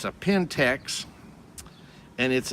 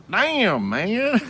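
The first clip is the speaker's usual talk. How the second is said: high, louder stunned exclamation; 10 semitones higher, 5 dB louder than usual. Retold or imitated. Teller recalls spotting someone famous in the glass, stunned. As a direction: re-enact the stunned reaction, pitched right up.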